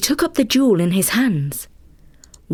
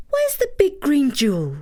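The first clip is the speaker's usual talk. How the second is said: higher, light fairy voice; 7 semitones higher than usual. Acted. Fairy telling Takitori she will stay, higher voice. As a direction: speak as the small fairy.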